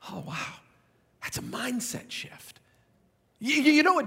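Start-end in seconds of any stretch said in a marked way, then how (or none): 0.1-2.6 breathy whispered aside, slowed; a quiet whispered aside to himself. Acted. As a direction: let it out in a hush.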